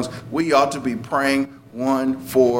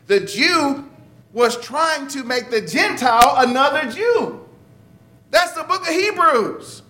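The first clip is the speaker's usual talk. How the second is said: much higher, pushy lecturing voice; insistent legalist; 7 semitones higher than usual. Retold or imitated. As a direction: press the Gentile to become just like you.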